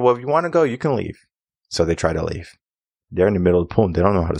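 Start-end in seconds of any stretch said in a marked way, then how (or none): 0.0-1.2 higher-pitched, offhand retort; mock-casual challenge. Swim teacher calling struggling kids' bluff, mock-casual challenge. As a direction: toss it out as a retold dare. Retelling his challenge to the kids.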